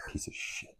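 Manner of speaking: whispered piece of shit; muttering at his laughing son; under-breath insult. Retold or imitated. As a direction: hiss it under your breath.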